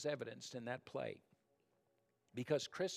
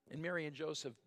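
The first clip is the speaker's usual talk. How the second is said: normal talking voice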